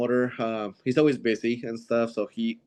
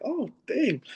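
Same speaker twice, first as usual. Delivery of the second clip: high, sudden surprised exclamation; surprised oh dang; 13 semitones higher than usual. Retold or imitated. Told he'd room with a friend, surprised. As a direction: pop up high in mock surprise. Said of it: "so I was like".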